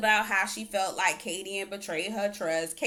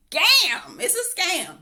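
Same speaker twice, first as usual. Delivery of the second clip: much higher and louder insistence; emphatic; 10 semitones higher, 8 dB louder, much slower than usual. Retold or imitated. Calling the show out, loud emphatic insistence. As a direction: hammer the verdict home. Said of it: "I'm like".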